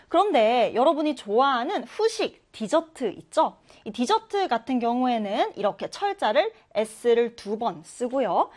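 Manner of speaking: ordinary delivery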